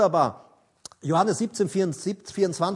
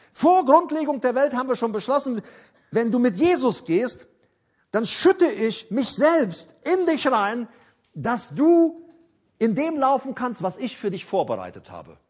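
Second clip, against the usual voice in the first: fervent preaching; 6 semitones higher, 4 dB louder than usual. Acted. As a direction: proclaim every word to the congregation. Preacher voices God's promise.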